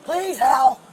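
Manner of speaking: loud, raw begging; screaming plea. Real, not acted. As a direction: scream the begging at full force. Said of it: "You wanna scream at me some more?".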